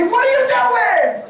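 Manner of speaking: very high, loud, slow shout; hammed-up heavenly rebuke; mock incredulous shout. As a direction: shout it like a loved one in heaven. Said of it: "they're gonna say".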